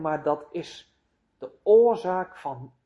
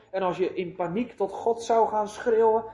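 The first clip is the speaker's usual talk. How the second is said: ordinary talk, nothing marked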